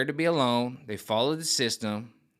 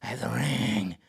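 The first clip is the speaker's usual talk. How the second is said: whispered creature voice clutching the ring; 3 dB quieter than usual. Retold or imitated. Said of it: "She's like".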